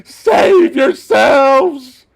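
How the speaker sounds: high, loud, drawn-out mock cry; hammed-up shout to the troops; mock panicked yell. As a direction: yell it like a fleeing coward. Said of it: "he's literally going".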